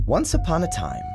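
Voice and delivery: ordinary delivery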